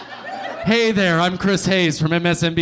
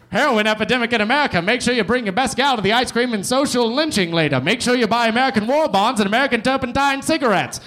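put-on fast, higher old-time radio announcer; 1940s radiocaster; 6 semitones higher than usual. Comic riffing as a forties radio host, brisk and bright. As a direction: sell it like a wartime newsreel. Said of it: "like a nineteen forties radiocaster".

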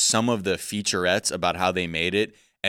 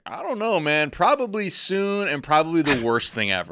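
imitating a scientist; 8 semitones higher than usual. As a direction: play a clueless expert. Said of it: "people at Caltech being like".

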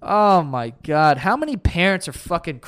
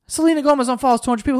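mocking headline; 8 semitones higher, much faster than usual. Shown as a demonstration. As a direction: sneer the headline back quickly. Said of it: "Who gives a fuck?".

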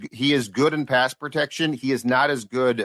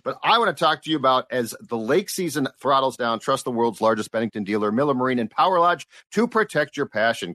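brisk host reading a sponsor spot; live radio ad read; 4 semitones lower than usual. Acted. Radio host reads the dealer ad to listeners, upbeat ad read. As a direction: pitch the boat deal to listeners.

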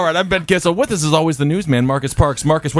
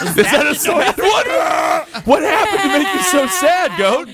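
bleating goat; 7 semitones higher than usual. Pretend. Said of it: "I'm bleating like a goddamn motherfucker".

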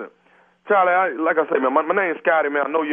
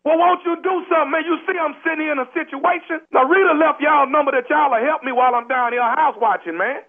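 1 desperate pleading; 7 semitones higher than usual. Acted. Desperate plea from bathroom.

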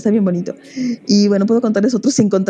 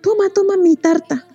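high frightened witch voice; scared witch; 11 semitones higher than usual. Acted. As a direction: squeak it out like a terrified witch. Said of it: "toda asustada".

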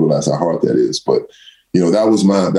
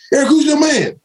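coach yelling. Retold or imitated. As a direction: bark the question like an annoyed coach. Coach yelling at missed block.

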